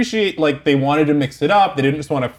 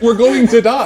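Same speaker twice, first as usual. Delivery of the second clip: laughing mock panic; 8 semitones higher, 6 dB louder than usual.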